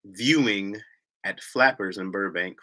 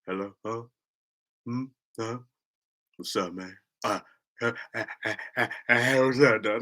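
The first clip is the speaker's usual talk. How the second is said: sleepy dude voice; 4 dB quieter than usual. Retold or imitated. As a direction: imitate a groggy man on the phone. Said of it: "go clear your throat, bro".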